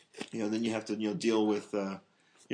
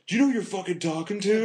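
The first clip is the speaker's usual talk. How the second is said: high-pitched, indignant movie quote; Rip; 9 semitones higher, faster than usual. Retold or imitated. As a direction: act out an affronted drug dealer. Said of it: "he's like".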